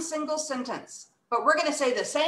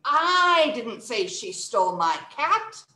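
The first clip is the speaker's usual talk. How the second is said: slow, with "I" stretched and strong; stress on I. Shown as a demonstration. Teacher leading students at home, stress on "I". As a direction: lean on "I", rise at "cat". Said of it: "Let's stress the first word".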